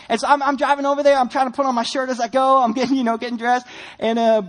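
ordinary talk

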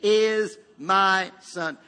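loud, very slow call-along; emphatic, stretched-out declaration; voice of the Father. Acted. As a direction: lead the congregation word by word. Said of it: "Everybody say that with me".

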